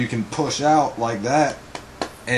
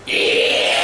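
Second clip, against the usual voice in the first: unvoiced high metal scream; 4 dB louder than usual. Shown as a demonstration. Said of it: "here you go".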